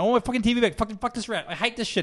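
high-pitched mock tantrum; retold angry yelling. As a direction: imitate a kid swearing at his dad. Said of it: "I'm, like, yelling at".

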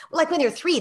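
ordinary delivery

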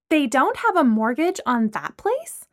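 high, slow, incredulous question; interrogating. A narrator's reading. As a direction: grill them, stretching out the disbelief. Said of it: "Lana was in full interrogation mode".

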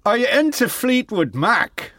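high, failed attempt at an Irish accent. Retrying the rock star's question, overdone Irish accent. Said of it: "I'm Irish and I can't".

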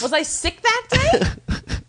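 high, slow, hammed-up whine; mock whine. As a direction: whine like a left-out kid.

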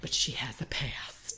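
ominous whisper; dramatic whisper. Real, not acted. Storyteller teasing Grace's dark past, whispered. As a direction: breathe it like a dark secret.

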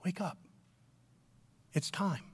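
Jesus calmly telling the sleeper it's time; soft wake-up. Shown as a demonstration. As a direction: wake them gently, close by. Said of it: "I think he's saying".

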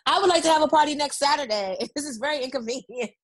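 mock complaint with laughter breaking in; demanding customer. Friend teasing the business owner as a would-be client, mock demanding. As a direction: play an inconvenienced client, half laughing. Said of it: "everybody's gonna go right to your business".

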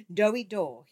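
a sudden loud cry, mocking. A narrator's reading. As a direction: call the nickname out loudly. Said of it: "he cried".

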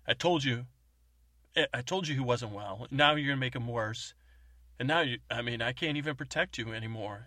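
loud, high indignant scolding. Retold or imitated. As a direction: berate them for making it worse. Agent angrily scolds others.

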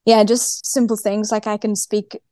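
ordinary delivery

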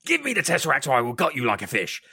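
a hint of a villain snapping a threat; playfully imitating a threatening Loki; mock Loki threat. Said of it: "absolutely threatening as hell".

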